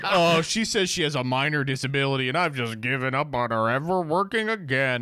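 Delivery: lightly voiced smug husband; a hint of a dismissive husband; mock husband quote. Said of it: "he's like".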